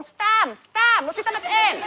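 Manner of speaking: mock innocence. Kid hiding snacks on the bus, feigning innocence. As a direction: stretch it high, far too casual.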